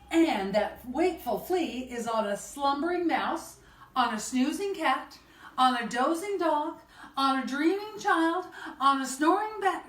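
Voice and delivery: lilting cumulative refrain, climbing as it goes; sing-song storytime narration, rising; picture-book reading. A narrator's reading. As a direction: build the list step by step for children.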